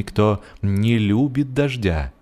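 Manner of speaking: usual speaking voice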